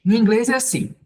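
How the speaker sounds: ordinary talk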